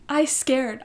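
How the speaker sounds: ordinary delivery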